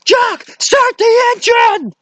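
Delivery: very high, loud desperate shout, often slow; running from the tribe, Indy shouts at the pilot Jock in a hammed-up high panic; panicked yelling. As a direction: scream for the pilot to take off. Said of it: "going like".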